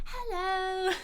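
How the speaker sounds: bright, high greeting to a friend; cheerful hello. Acted. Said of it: "smiles and goes".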